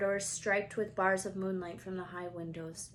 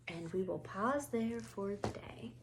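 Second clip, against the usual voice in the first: soft whispered sign-off; 7 dB quieter than usual. Real, not acted. Reader ending the session for Kenny, soft whisper. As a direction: whisper the goodbye. Closing the reading softly.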